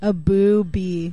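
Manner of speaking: slow, drawn-out boo bee; slow punchline. Real, not acted. Guest repeating the host's bee joke, slow. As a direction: stretch out boo bee slowly. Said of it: "repeating the joke".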